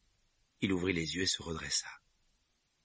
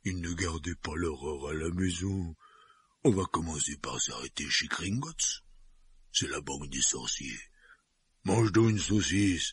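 giant voice. Acted. Hagrid voiced by narrator.

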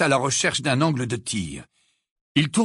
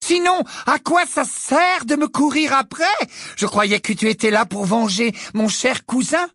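mocking baby voice; 9 semitones higher than usual. A narrator's reading. Bellatrix taunts in baby voice.

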